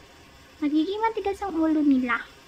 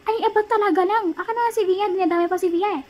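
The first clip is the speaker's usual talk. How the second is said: ordinary talk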